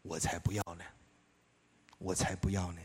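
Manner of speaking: a grudging dark voice inside; dark inner voice. Retold or imitated. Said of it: "黑暗的声音对我说". (低沉阴暗的内心声音)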